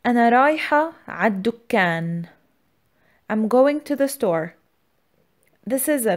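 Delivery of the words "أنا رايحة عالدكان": usual speaking voice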